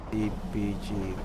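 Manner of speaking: ordinary talk, nothing marked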